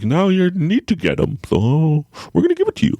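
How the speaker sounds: high, put-on voice of a teasing mind; mock-menacing high singsong; taunting inner voice. Mind taunting him with panic attack.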